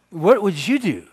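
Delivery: high, loud panicked question; high, loud panicked thought. Retold or imitated. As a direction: blurt it out in panic.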